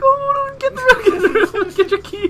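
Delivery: high frantic squeaky panic; panicked squeal. Retold or imitated. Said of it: "both being like".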